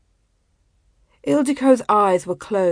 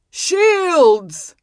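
loud, high battle cry; sudden urgent shout of alarm; bellowed warning; 9 semitones higher, 6 dB louder than usual. A narrator's reading. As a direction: bellow the command to your troops. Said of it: "he bellowed".

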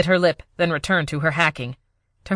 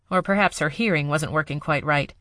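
usual speaking voice

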